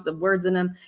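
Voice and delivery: normal talking voice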